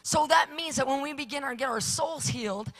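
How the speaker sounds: loud, rising preacher's fervour; fiery revival preaching; full-force preaching. Acted. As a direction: preach it, climbing to a shout.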